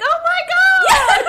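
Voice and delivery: very high, loud, drawn-out mock gasp; mock startled shriek. Teasing her about dodging a kiss, mock startled shriek. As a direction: shriek it as a hammed-up interruption.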